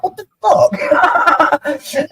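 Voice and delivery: slow, high, laughing disbelief. Retold or imitated. Man recalls his stunned reaction to the false claim, slow laughing disbelief. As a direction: stretch it out in laughing shock. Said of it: "And I'm like".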